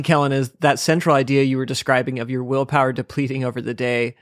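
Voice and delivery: ordinary talk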